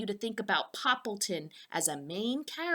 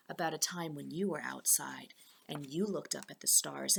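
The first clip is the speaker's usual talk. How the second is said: hushed and softer; soft confiding whisper; whispered aside; 5 semitones lower, 5 dB quieter than usual. Real, not acted. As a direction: whisper it like a shared secret. Teacher hushes while recalling stargazing.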